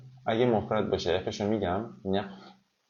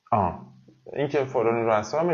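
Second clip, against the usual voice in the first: normal talking voice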